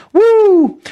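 high, loud whoop of joy; joyful whoop. Real, not acted. Pastor whooping with joy at the affirmation. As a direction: let out a happy cheer. Joyful cheer mid sermon.

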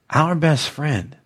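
ordinary delivery

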